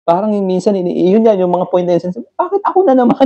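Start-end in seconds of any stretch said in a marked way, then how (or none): 2.3-3.2 much higher, exasperated whine; a cry of why me, pitched way up; exasperated self-talk. Retold or imitated. Driver to himself after another crash, exasperated and high. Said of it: "Sabi ko sa sarili".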